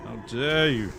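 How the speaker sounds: loud, offended demand; indignant outrage. Acted. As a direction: bellow it like an insulted noble. Indignant NPC confrontation.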